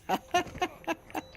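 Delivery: sudden very high laugh; burst of laughter. Real, not acted. Streamer cracking up at a gamertag. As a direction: crack up at a silly name.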